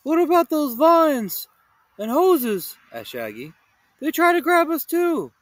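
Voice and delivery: high loud protest; insist, worked up; Shaggy protesting loudly to Velma. Acted.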